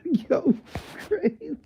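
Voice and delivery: high squeal mid-laugh. Real, not acted. Vlogger losing it over the hot dog story, squealing with laughter. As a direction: squeak it out while cracking up.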